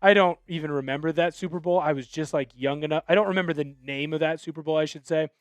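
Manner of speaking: ordinary talk, nothing marked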